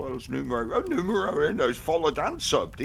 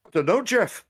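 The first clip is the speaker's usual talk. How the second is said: mock field reporter; 7 semitones higher, 6 dB louder than usual. To our reporter, Duderino.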